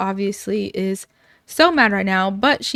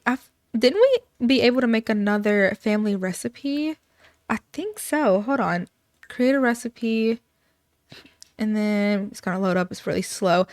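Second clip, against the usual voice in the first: usual speaking voice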